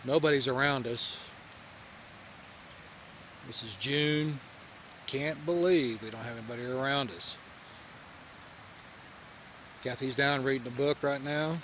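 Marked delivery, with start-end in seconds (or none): none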